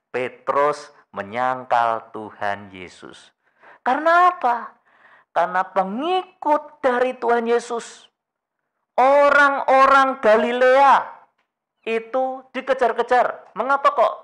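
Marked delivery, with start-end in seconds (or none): 3.8-14.1 high, loud impassioned preaching; raised, ringing pulpit voice; full-force preaching. Acted. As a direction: preach it out with urgency. Passionate sermon build.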